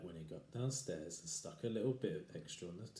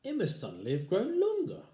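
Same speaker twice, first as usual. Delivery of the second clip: puzzled wife; 13 semitones higher, 7 dB louder than usual. Acted. Wife's voice in story.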